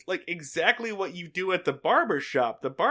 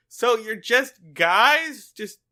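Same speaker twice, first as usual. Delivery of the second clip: 1 baffled whine; 5 semitones higher, slower than usual. Retold or imitated. Opie baffled by club.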